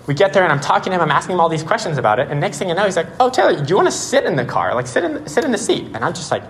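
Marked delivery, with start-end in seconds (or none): 3.2-5.9 lightly imitated friendly offer, pitched higher; retold casual invitation in a raised pitch; inviting car owner.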